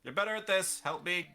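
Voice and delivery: loud urgent call for help; shouting. Acted. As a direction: shout it out, straining. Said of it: "and I just shout".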